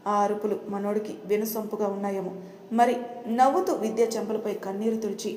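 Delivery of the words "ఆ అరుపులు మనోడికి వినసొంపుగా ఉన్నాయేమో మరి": usual speaking voice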